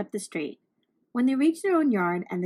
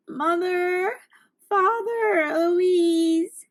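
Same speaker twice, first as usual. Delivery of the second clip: higher, louder tearful call for family; crying out; 8 semitones higher, 3 dB louder, much slower than usual. A narrator's reading. Lost girl crying for her family, tearful cry. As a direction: call for mother, father and sister through tears. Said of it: "she cried".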